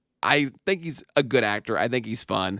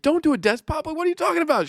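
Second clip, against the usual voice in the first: high-pitched mock-incredulous scolding; imitated indignant outburst; faster than usual. Retelling a cop scolding his partner over the desk pop, high-pitched indignation. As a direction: act out a movie cop's high, exasperated protest. Retold movie scene, alarmed scolding.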